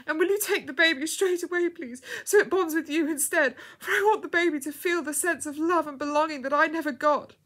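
high, loud, urgent begging; desperate plea. A narrator's reading. Girl in labour entrusting her baby, desperate high plea. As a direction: plead urgently through the pushing. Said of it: "The girl cried out".

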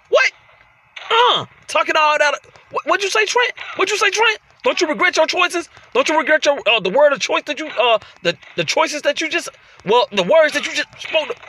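high, stumbling indignation; flustered trash talk, restarting phrases; too mad to talk. Pretend. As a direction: trip over the words in worked-up outrage. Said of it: "I'm so mad right now, I can't even talk".

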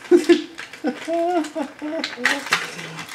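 high rising squealing laugh; real delighted laughter; high giggle. Real, not acted. Laughing at own excitement.

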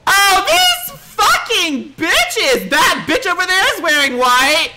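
high, loud, drawn-out rant; furious yelling. Acted. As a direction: shriek it in outrage, stretching the curses.

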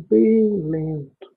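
slow, lingering "bem", then an unhurried "lento"; drawn-out slow. Real, not acted. Guide slowing listeners' finger-rubbing, drawn-out and slow. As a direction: stretch "bem" out long and slow. Stretches words while guiding slowness.

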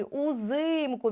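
УЗИМКУ slowly, the И held long; наголос на И. Shown as a demonstration. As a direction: draw out the stressed И in УЗИМКУ. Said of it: "Ми чітко чуємо и". (УЗИМКУ повільно, з протяжним И)

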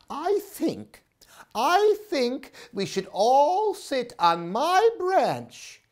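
big sister owl calling for Mommy; worried big sister owl. Acted. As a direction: ask for Mommy in a fretful high voice. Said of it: "said Sarah".

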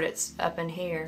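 usual speaking voice

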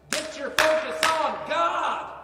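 slow, loud call to the room; shouted exhortation. Real, not acted. Preacher shouting at his congregation to refocus, slow loud shout. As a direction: bellow it out to the congregation.

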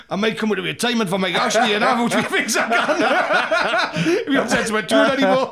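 laughing loud through the words; can barely talk for laughing. Real, not acted. Laughing story about retirement.

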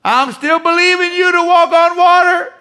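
high, loud whining plea to Jesus; hammed-up believer pleading from the boat; mocking a believer who won't step out; mock Peter crying out. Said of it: "Well, you're not walking on water".

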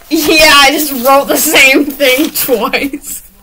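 loud, high, drawn-out amusement; slow, loud, giggly confession; laughing. Real, not acted. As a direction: admit it while cracking up. Laughing at own writing mistake.

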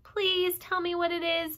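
mild hammed-up begging. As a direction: plead like a small pig. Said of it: "begged Wilbur".

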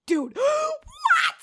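high gasping shout of shock. Real, not acted. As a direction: yelp it in total disbelief. Shock at pregnancy news.

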